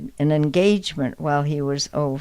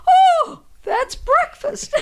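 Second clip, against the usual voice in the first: sudden realization; 5 dB louder, slower than usual. Retold or imitated. Realizing the name was breakfast.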